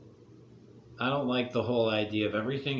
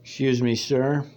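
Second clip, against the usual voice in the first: calling out; 8 dB louder than usual. Acted. Calling out a maskless stranger.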